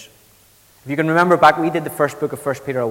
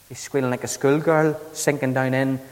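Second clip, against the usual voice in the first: usual speaking voice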